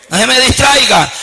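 usual speaking voice

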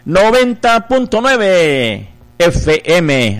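slow, drawn-out announcer call; radio station call-out. Real, not acted. Radio host calls out station frequency.

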